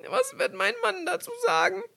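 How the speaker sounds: sehr hoch, weinerlich verzweifelt; ängstlicher Ruf. Acted. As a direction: angstvoll aufschreien. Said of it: "rief sie". (die Königstochter weint über die Scherben, sehr hoch)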